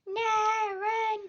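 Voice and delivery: high urgent cry; panicked. Real, not acted. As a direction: squeal it out in alarm. Fleeing in game.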